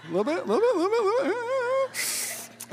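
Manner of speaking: tiny, very high, soft voice; hammed-up falsetto; comic squeak. Comic echo of the friends.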